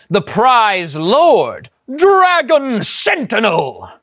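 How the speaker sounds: slow, grand epic narrator; dramatic announcement. A narrator's reading. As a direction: draw out the boss's name with awe. Narrator announces legendary boss.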